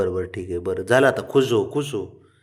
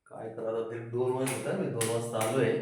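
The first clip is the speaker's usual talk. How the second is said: normal talking voice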